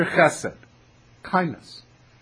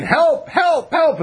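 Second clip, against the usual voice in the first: high-pitched cry for help; acted panicky yell; 12 semitones higher, slower than usual. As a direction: play a man yelling for his life, pitch jumping up. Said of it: "he starts yelling".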